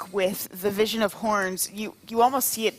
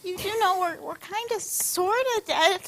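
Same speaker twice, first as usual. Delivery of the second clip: high creature voice; 11 semitones higher than usual. Acted. DM voices the monsters.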